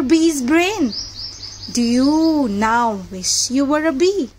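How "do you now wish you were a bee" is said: ordinary delivery, nothing marked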